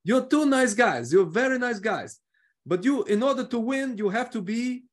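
mimicking a Portuguese manager's English; Portuguese accent. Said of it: "that's exactly what he sounds like".

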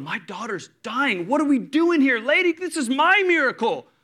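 high, loud, desperate father; building to an indignant shout; frantic protest. Acted. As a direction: plead and protest, pitch climbing sharply. Acting Jairus panicking at the delay.

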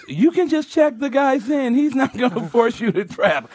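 high-pitched mock whimper; imitated whiny coaxing; pleading woman imitation. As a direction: plead in a put-on high girly voice.

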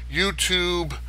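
normal talking voice

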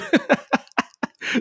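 high breathy embarrassed laugh; sheepish laugh. Real, not acted. Host apologizing to listeners for pessimism, high sheepish laugh. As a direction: laugh off your own gloom.

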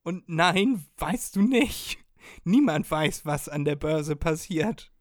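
loud, slow and emphatic; loud rebuttal. Real, not acted. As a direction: argue back hard and slow. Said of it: "rebutting the ad loudly".

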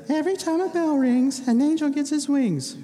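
put-on high childlike voice quoting her teacher; little girl voice. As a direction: play a small child reciting a saying. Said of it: "Teacher says".

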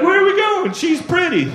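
dopey stoner whining for his new friend; whiny stoner. Retold or imitated. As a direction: pine for the dog as they drag you off. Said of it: "And I'm like".

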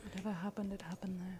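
hushed dramatic echo; stage whisper. Pretend. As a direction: breathe it like a secret. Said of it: "Stage whisper".

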